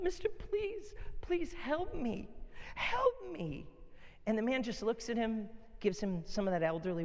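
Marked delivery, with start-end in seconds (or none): 0.0-3.7 put-on high, slow begging little-kid voice; pleading child. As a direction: beg the old man as a frantic child. Kid begging the old man.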